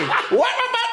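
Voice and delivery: high, laughing "wah-wah" engine noise; imitated engine revs. Rider taking off from angry man, mimicked revving. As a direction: rev like a quad through laughter.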